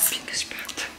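breathy whisper, barely voiced; whispered aside. Real, not acted. Whispering to viewers before the treatment starts. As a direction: drop to a whisper for the camera.

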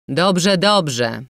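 mildly irritated concession; displeased interruption. A narrator's reading. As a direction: cut in, a touch displeased. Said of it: "przerwał pan niezadowolony". (lekko niezadowolone przerwanie)

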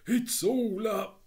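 slow, loud, cornered Cockney cry; a desperate burglar hearing police boots. Acted. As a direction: sound trapped and done for. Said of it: "said the man desperately".

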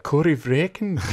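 Corey Vrecken in a high, loud mock Scottish accent; hammed-up Scottish accent. Joking to his co-host in an over-the-top Scottish accent. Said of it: "to bung on a Scottish accent".

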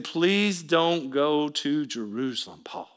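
soft, slow, low plea; imitating grief-stricken begging, understated; tearful pleading. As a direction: plead quietly and heavily, without real sobs. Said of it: "crying and sobbing".

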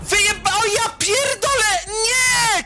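high anguished yell; despairing shout. Real, not acted. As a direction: cry out in disbelief. Dies in game, shouting. (rozpaczliwy krzyk)

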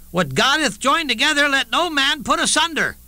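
high, eager schoolboy shout; proudly blurting the answer; boy crying out. Retold or imitated. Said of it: "And Willie cried out".

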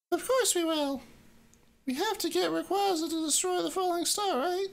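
high determined Mudkip. Acted. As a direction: chirp it with brave certainty. Mudkip answers.

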